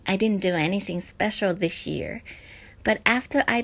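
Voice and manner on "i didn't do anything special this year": normal talking voice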